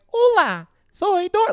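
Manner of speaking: high, loud cartoon girl voice; Dora. Imitating the female skeleton, high mock Dora voice. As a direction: squeal it in over-Americanized Spanish. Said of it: "homegirl started talking like".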